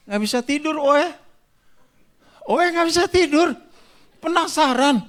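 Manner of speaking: high, loud sleepless outburst; comic restless curiosity. Retold or imitated. Acts out sleepless curiosity about envelope. (penasaran heboh, suara tinggi dan keras)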